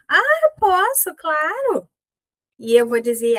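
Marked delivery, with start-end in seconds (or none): 0.1-1.9 bright high eager agreement; "Ah, eu posso" sung out high and willing; eager yes. Shown as a demonstration. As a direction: say yes gladly, voice jumping up. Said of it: "a minha linguagem corporal tá assim".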